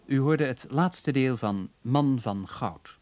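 ordinary delivery

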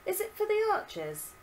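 put-on high questioning girl; young girl voice. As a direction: ask it as a curious girl. Violet asks.

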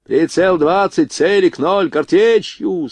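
high, ringing firing order; military command. A narrator's reading. Officer orders his gunners to aim, commanding call. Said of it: "скомандовал Родионов".

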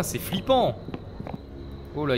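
ordinary talk

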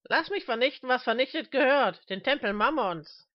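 high, loud, defiant demand; heated high-pitched outburst; raging plea. Acted. Smith rages at the old man.

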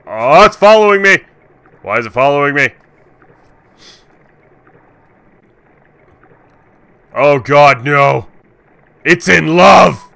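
panicked high shrieking; screaming in alarm; panicking gamer. Real, not acted. Monster chases him, panics.

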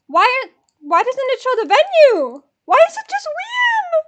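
high, loud, stretched-out protest; alarmed wail. Real, not acted. Fan baffled by the ticket page, alarmed wail.

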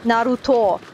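"Naruto" stressed on first and last syllables; two stresses. Shown as a demonstration. Describing the Japanese version, "Naruto" stressed on first and last syllables. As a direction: hit both "Na" and "to" in "Naruto". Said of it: "two stresses on the first syllable and on the last".

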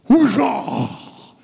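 high, loud hammed-up faith-healer blast; Benny Hinn shout. Preacher mocking Benny Hinn blasting his congregation, loud high hammed-up shout. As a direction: let it rip like a showman preacher knocking the crowd over. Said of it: "and he goes".

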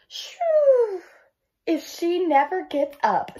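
put-on high, slow kid voice; sly and hopeful; cheeky brother. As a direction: brother eyeing his sister's room.

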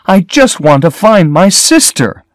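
high, loud and upset, pleading; cried unhappily. A narrator's reading. Lila pleads with the sheriff, high and upset. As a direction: push the words out in distress. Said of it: "Lila cried unhappily".

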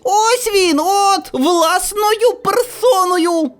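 put-on high-pitched child's yell; boy crying out. A narrator's reading. Boy in glasses spots the narrator, crying out. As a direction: pipe up in a high, accusing boy's cry. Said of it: "та як закричить".

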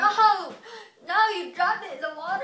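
high, loud shocked cry; shocked shout. Real, not acted. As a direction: react in loud disbelief. Shocked outburst.

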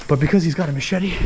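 ordinary delivery

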